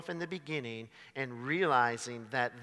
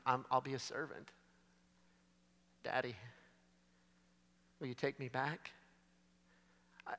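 much softer, broken apology to Daddy; the son returning home begging his father's forgiveness; humble pleading; 2 semitones lower, 12 dB quieter than usual. Acted. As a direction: beg quietly to be taken back. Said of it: "Now he says".

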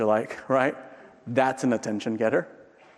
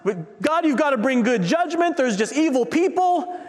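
Habakkuk pleading urgently; 10 semitones higher than usual. Acted. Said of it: "And he's saying".

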